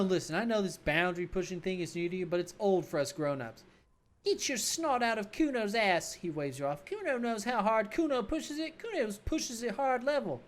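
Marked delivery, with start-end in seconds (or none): 4.2-6.1 a hint of the high brat; grudging kid voice, a bit higher; lightly voiced bratty kid. Calmer kid Kuno grudgingly talking to the cop, lightly voiced. 6.8-10.4 a hint of the high brat; grudging kid voice, a bit higher; lightly voiced bratty kid. Calmer kid Kuno grudgingly talking to the cop, lightly voiced.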